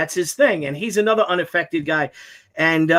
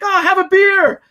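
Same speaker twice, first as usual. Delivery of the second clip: high loud offer of a beer; mock rocker, pitched way up and loud; 12 semitones higher, 6 dB louder than usual. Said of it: "And he's like".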